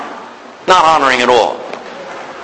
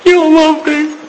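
mock tearful wife, much higher and rising; imitating tearful wife; 12 semitones higher than usual. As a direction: play the hurt old wife, pitch climbing. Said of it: "and she's crying".

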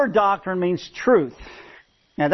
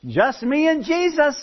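parrot voice; 7 semitones higher than usual. Retold or imitated. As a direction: answer from the dark like a parrot. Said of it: "The parrot is saying".

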